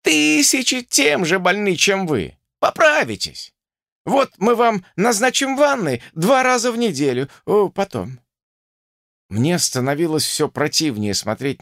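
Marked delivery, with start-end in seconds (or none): 0.0-8.2 put-on high-pitched, breezy talking-down as if to small children; cheerfully indifferent doctor soothing a patient; good-natured professor. A narrator's reading. Professor prescribing baths to a sick woman, good-natured and patronizing. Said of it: "благодушно ответил профессор".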